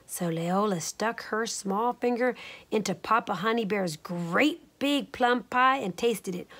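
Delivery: usual speaking voice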